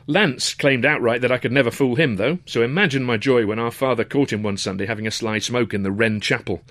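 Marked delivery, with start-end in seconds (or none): none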